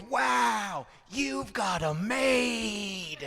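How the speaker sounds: high, drawn-out awed wow; slow, starstruck kid voice; amazed kid. Retold or imitated. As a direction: gasp it like a wide-eyed kid. Kid amazed at Spanish-speaking mom.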